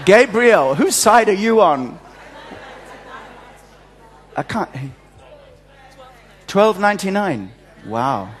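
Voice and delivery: ordinary talk, nothing marked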